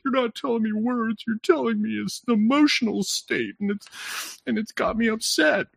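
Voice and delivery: high, hurt woman's voice; upset woman. Retold or imitated. Wife answering husband, high upset voice. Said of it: "she's like".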